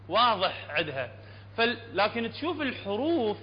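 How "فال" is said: ordinary talk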